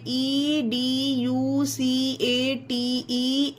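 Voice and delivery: usual speaking voice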